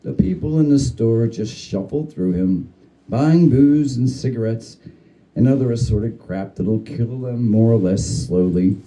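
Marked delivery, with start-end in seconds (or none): none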